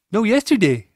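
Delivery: put-on toddler insisting. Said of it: "She's like".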